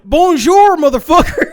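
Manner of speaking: high, loud laughing mock French accent. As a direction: bark it like a cartoon Frenchman, laughing. Mocking Europeans, French greeting.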